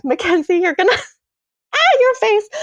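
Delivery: high, laughing squeal. Real, not acted. Storyteller cracking up at her friend's reaction, laughing. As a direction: crack up mid-sentence. Breaks up at listener's face.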